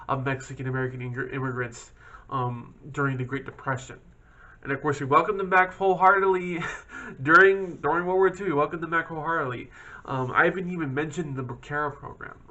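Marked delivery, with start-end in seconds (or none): 4.6-9.7 biting sarcasm, raised and louder; sarcastic. Real, not acted. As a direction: sneer it with a dry laugh. Sarcastic about wartime welcome.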